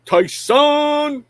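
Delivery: a loud, high call of the name; excited greeting. Real, not acted. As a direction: hail a friend across the room. Greeting a viewer on stream.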